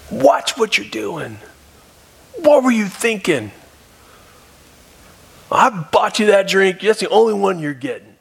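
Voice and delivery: dad scolding his son over the spilled drink, mock anger; imitating an angry dad. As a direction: play the dad erupting at his son, hammed-up. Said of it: "for the dad to erupt".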